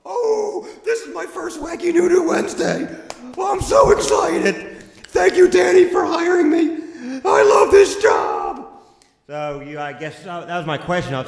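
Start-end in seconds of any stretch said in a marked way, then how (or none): 0.1-8.8 put-on high, loud, giddy kid voice; squeaky eager burger boy; excited nerdy teen. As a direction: bounce in as an overexcited new hire. New character Billy Bopper arrives.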